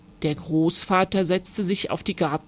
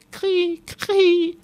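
very high bird cry; laughing dove call; 10 semitones higher, 3 dB louder than usual. A narrator's reading. Starling trying the laughing dove's song, high cooing cry. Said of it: "das Lied der Lachtaube".

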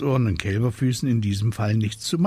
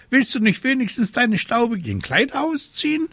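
high-pitched outraged bird voice; indignant raven; 3 dB louder than usual. A narrator's reading. Raven scolding the witch on her bed, indignant. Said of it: "rief Abraxas entrüstet".

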